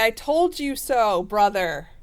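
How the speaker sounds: gloating I-told-you-so, playfully hammed up. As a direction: Scorpio lecturing the sibling, mock scolding tone. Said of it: "you're gonna say".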